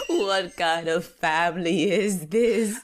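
slow, hammed-up appalled shout; drawn-out mock wail. Snake appalled at the whole family, drawn-out mock wail. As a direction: stretch every word in mock outrage.

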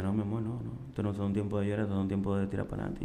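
soft, gentle reassurance quoted from his wife; comforting wife. Retold or imitated. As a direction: soothe him like a loving wife. Wife voiced, comforting husband. (esposa consolando, voz suave y tierna)

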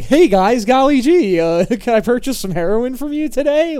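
high, loud, over-eager dork voice; naive undercover cop. Retold or imitated. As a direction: gush it out like a clueless narc. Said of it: "and I'm just like".

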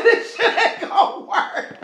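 high, slow, laughing quote. Retold or imitated. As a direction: repeat it cracking up. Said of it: "He said".

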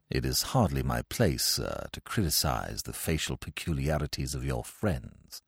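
low, soft, correct manservant; butler's voice. Acted. Butler voice in narration.

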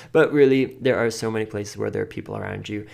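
ordinary talk, nothing marked

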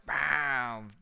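loud, high "bam"; explosive storytelling exclamation; punched sound effect. Retold or imitated. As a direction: hit it like a sudden launch.

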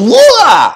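high, loud, imitated yell; mock battle yell. Wrestler snapping as he swings the hammer, mock yell. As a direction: burst out with a short mock war cry. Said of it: "Then he goes".

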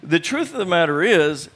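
usual speaking voice

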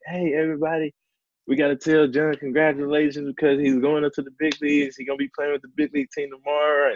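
high-pitched dad calling the family together; excited retold announcement, sustained high; imitated proud announcement. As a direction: announce big news to a roomful of relatives.

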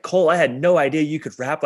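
amazed, high and loud; excited exclamation. Retold or imitated. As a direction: burst out in amazement. Everybody's amazed reaction quoted.

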